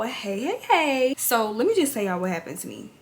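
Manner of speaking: ordinary delivery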